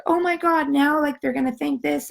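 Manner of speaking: high, mildly panicky self-quote; mock panic. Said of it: "panicked, like".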